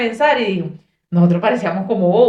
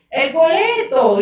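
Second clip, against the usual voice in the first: insistencia imitada; 8 semitones higher, 4 dB louder, slower than usual. Retold or imitated. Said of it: "¿Qué?".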